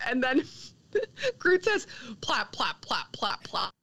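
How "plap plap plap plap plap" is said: spoken pretend farts, voiceless popping syllables; mock fart sound. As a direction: pop out five breath-only plaps as a fake fart. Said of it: "that was me making a fart sound".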